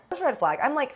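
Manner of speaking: ordinary delivery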